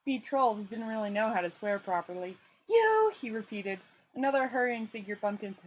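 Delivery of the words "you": high, loud furious repeat; angry. Acted. Rincewind about to curse the tourist. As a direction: spit it out in fury.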